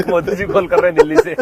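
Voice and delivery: loud and high, words bursting through laughter; laughing tease. Real, not acted. Teasing his friend about the Modi ji contact name, laughing loudly. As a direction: laugh out the joke about the caller.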